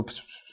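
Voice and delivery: hissed pss pss whispers; imitated whispering. People at the door whisper about the dead son, voiceless hissing. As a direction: mimic people whispering behind his back. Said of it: "flüstern so".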